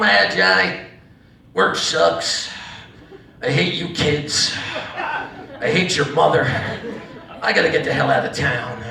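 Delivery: low, halting gripe; weary father. Retold or imitated. Father comes home to drinking buddy son, weary griping. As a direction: grumble low with long pauses, as a dad.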